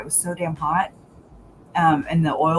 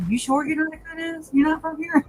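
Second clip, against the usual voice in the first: high, half-joking question; quizzical quote; 11 semitones higher than usual. Retold or imitated. Asking her Cajun friend, high and teasing. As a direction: tease him about being a coon ass. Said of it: "I was like".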